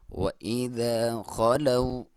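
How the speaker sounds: slow, drawn-out tajweed chant; slow Quran recitation. Shown as a demonstration. Teacher reciting the verse for students, slow and drawn out. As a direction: recite it slowly, stretching each vowel. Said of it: "वाव लीन कहते हैं".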